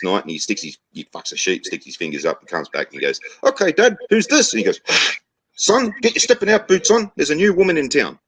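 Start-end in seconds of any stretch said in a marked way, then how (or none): 3.4-4.5 very high-pitched son voice; acted cocky high-pitched question; challenging son. Son repeats challenge in joke. 5.6-8.2 put-on very high, excited chief in a Kiwi accent; hammed-up New Zealand accent, pitched right up. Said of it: "New Zealand accent there".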